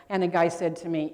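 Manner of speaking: normal talking voice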